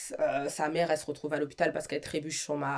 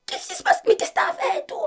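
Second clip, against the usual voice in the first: very high, breathless frightened cry; a terrified girl asking what happened; panicked sister; 8 dB louder than usual. Retold or imitated. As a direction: gasp it out in fright. Little sister asks, panicked.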